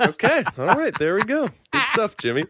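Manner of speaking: high, laughing, amused reply. Retold or imitated. Retelling his own amused reply.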